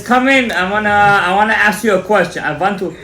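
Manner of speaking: put-on high, loud stab at a Russian accent; attempted Russian accent. Shawarma owner calling the rabbi in, attempted Russian accent. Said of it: "I can't do the Russian accent".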